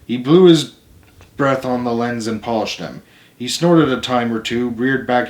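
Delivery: ordinary delivery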